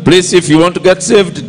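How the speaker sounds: ordinary delivery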